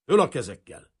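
loud, sharp command; armed order. A narrator's reading. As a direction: call it out as an order at gunpoint. Detective aims revolver, orders hands up.

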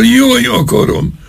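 high, slow pained wail; high anguished wail. A narrator's reading. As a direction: moan it out in agony. Colonel in magical pain.

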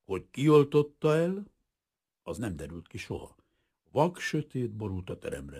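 usual speaking voice

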